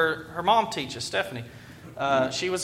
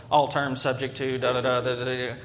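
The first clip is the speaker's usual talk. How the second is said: ad fine print disclaimer; 6 semitones lower than usual. Retold or imitated. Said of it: "speaking a little more quietly and much more quickly".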